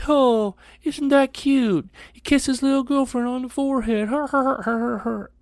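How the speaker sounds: high sneering teasing voice; mock him, sing-song and high; older kids taunting a first grader, high and mocking; mocking bully voice.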